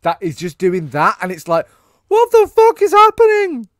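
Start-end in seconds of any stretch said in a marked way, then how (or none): 2.1-3.7 high, far louder incredulous shout; exasperated yell. Acted. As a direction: yell it out in hammed-up bewilderment. Exasperated reaction to the character's rant.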